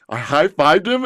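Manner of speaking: usual speaking voice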